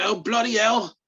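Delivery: high-pitched, hammed-up British accent; outraged British fan, accent badly faked; terrible British accent. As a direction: do a bad British accent, jeering and indignant. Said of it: "sorry about my terrible British accent".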